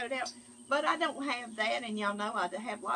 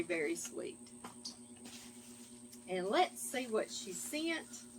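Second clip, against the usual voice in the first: usual speaking voice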